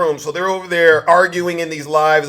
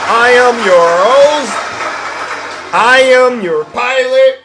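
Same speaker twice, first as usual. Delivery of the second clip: slow, loud announcer voice; grand slow showman opening; showman intro; 5 semitones higher, 6 dB louder, slower than usual. Acted. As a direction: boom it out like a ring announcer. Host opening his live show.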